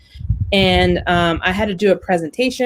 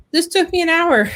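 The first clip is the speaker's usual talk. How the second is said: exasperated protest; 9 semitones higher than usual. Retold or imitated. Boss demands rewrite again.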